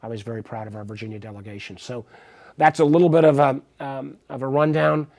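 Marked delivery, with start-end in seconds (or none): none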